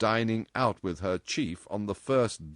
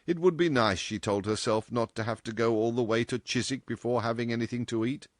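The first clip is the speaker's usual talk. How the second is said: ordinary delivery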